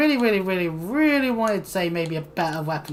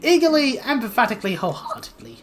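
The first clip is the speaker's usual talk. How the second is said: excitable, high-pitched little creature; insistent kobold; 5 semitones higher, 5 dB louder than usual. Acted. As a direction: press them eagerly as a needy kobold. Voices the pleading kobold.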